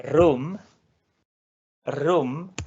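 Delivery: room said slowly and clearly; pronunciación modelo. Shown as a demonstration. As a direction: model the Swedish word room. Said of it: "aquí por ejemplo tenemos et". (pronuncia room claramente)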